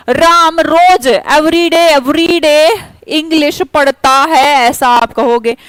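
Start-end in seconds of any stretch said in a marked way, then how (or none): 0.1-4.4 "every day" drawn out slowly and hit hard; stress on every day. Shown as a demonstration. Teacher stressing the added clue word for her students. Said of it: "एक extra information मैंने दे दी".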